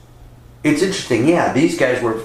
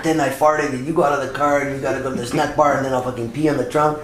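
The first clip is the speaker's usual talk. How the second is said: a hint of a stoned buddy pitching a sketch; lightly voiced lazy stoner; mock stoner voice, a bit higher; stoned dude; 4 semitones higher, 3 dB quieter, faster than usual. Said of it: "smoke a bowl and go".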